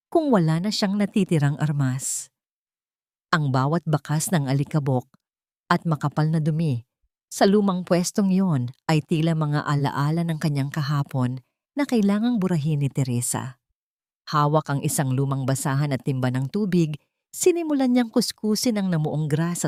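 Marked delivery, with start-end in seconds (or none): none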